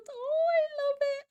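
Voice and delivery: high, delighted squeal; excited squeal. Real, not acted. Judge squeals over Doodles' cute build. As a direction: squeal it out with joy.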